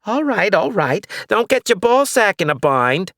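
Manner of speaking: put-on high, crotchety old lady; cranky old woman. Old neighbor ranting about the witch, high and crotchety. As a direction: rant like a suspicious old neighbor.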